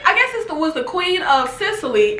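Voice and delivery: usual speaking voice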